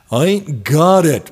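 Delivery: a hint of a New York accent; lightly put-on New York accent. Guitarist tells manager he has no cash, light New York accent. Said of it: "giving him a New York accent".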